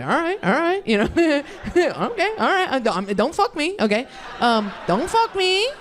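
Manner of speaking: backing off from scary guys, high-pitched; hammed-up nervous pleading; mock nervous. Nervous retort to big fighters.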